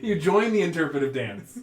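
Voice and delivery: loud, high and amused; laughing. Real, not acted. Game master confirming the player joins the dance, laughing loudly. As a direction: declare it through laughter.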